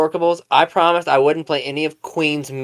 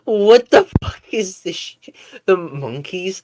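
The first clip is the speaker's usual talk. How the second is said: shocked; 4 semitones higher than usual. Real, not acted. Reader shocked by the story.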